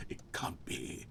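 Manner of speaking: sudden hushed whisper. Pretend. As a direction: drop to a secret whisper. Dramatic aside inside mock monologue.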